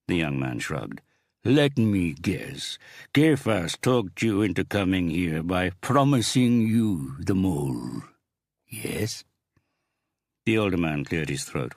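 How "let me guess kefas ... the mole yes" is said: slow, gloating gang boss; menacing crime boss. Acted. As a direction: savor every word, threatening. Big boss voiced in story.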